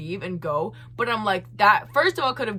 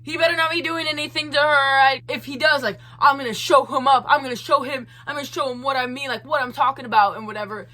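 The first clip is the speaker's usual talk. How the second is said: higher, louder mock bluster; imagined Chad voice; 4 semitones higher, 3 dB louder, faster than usual. Freaked-out man vowing to show him, mock bluster. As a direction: puff it up into a hammed-up threat. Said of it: "that's how he sounds in my head".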